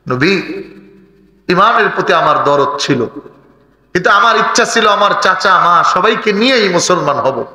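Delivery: ordinary talk, nothing marked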